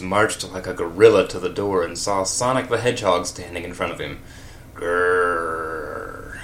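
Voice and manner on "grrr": low growl, like an animal; lightly voiced angry growl. A narrator's reading. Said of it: "growled Alex Jones".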